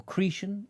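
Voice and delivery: "Cretan" said CREE-shun, with sh; questioning, high-pitched "Cretan" as CREE-shun; pronunciation option. Shown as a demonstration. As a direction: say "Cretan" to rhyme with "secretion". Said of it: "A type of fabric pronounced".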